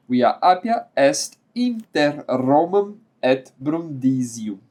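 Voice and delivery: slow, measured reading of Latin road names. Acted. Teacher reading Latin road names to his students, slow and measured. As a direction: let each name land for the class. Reads the list of roads.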